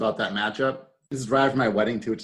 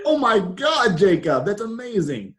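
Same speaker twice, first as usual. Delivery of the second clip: excited; 8 semitones higher than usual. Retold or imitated. Jacob confesses over the phone.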